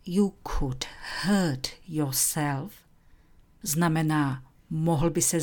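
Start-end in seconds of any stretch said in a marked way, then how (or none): 0.0-2.7 slow example. Shown as a demonstration. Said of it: "A vazba".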